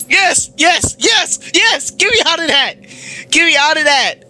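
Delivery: high frantic screaming pleas; panicked yelling. Real, not acted. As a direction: yell it high in total panic. Frantic yelling during jumpscare.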